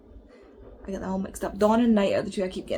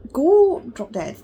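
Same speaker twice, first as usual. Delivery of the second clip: louder and slow, spitting it out; fed-up snap; 6 semitones higher, 7 dB louder, slower than usual. Real, not acted. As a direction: tell the character off in disgust. Ranting at a hated book character.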